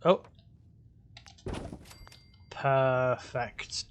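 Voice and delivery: ordinary talk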